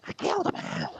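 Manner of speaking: high breathy whispered confession; hammed-up creepy confession; mock confession. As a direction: whisper the dark secret in the squeaky voice. Patient confesses murder.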